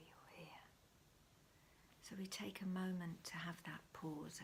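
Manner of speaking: hushed whisper all through; breathy whisper, barely voiced. Real, not acted. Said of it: "I don't know why I'm whispering".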